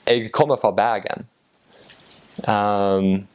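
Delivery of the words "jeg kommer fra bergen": Western Norwegian Bergen accent, throaty R; Bergen dialect. Shown as a demonstration. Showing how someone from Bergen says it, Western Norwegian dialect. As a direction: say it like a Bergen local. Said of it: "I'll say that again".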